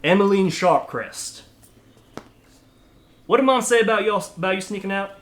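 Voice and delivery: a big brother catching her sneaking out; scolding older brother. Acted. Big brother to his little sister, scolding. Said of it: "She's like".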